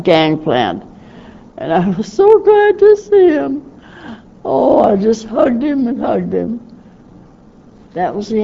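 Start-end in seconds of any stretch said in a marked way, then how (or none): 1.6-6.7 overjoyed. Real, not acted. Reunion with youngest brother.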